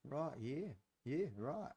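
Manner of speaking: soft, playful Aussie imitation with swooping pitch; lightly mocking Australian listener murmurs; Australian accent. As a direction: mimic Australian mates chiming in, "roit". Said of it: "they're like".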